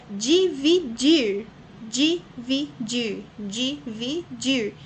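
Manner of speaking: "dividir" very slowly as DI - VI - DIR; three separate pulses in each "dividir"; syllable by syllable. Shown as a demonstration. Teacher counts three syllables aloud, beat by beat. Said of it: "Três sílabas".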